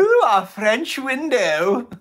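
high, loud, drawn-out mock wail; hammed-up slow high cry; mock alarm. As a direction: wail it in mock panic, stretched out. Mock wail over the window.

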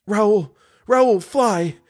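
high, loud plea to flee; urgent warning. A narrator's reading. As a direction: cry out in high-pitched alarm. Captive begs lover to flee.

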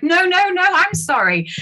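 high, emphatic comic apology; mock over-apologetic. Shopper outdoing a stranger's apology, mock over-apologetic insistence. Competing apologies acted out in story.

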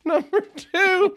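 high giggling, slowing down; laughing. Real, not acted. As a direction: giggle through the words. Laughing while reading the letter.

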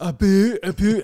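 high, loud mock Canadian "a boo"; heckler's loud, high Canadian accent. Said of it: "some people hate the Canadian accent".

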